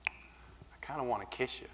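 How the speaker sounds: whispered confession, very soft; shy whisper. Retold or imitated. Confessing to Eric in the car, shy whisper. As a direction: whisper it like a nervous secret. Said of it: "And I said".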